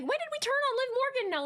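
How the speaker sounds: high, fast incredulous question; exasperated disbelief. Retold or imitated. Podcaster sick of the same debate coming back, exasperated disbelief. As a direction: shoot up in pitch, quick and baffled.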